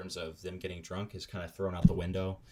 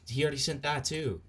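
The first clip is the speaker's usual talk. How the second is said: higher, exasperated repetition; exasperated repeat; 7 semitones higher, 4 dB louder than usual. Real, not acted. Streamer spotting repeated messages, exasperated. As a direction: say it more insistently, pitched up.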